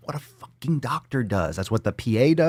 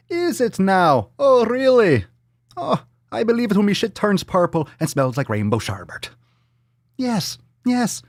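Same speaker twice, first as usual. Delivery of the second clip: high-pitched, hammed-up English-Irish accent; muddled English-Irish accent; 8 semitones higher than usual. Podcaster scoffing at a tweet, high mock English-Irish accent. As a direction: squeal it in a wandering mock English-Irish brogue. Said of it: "I don't know what accent this is".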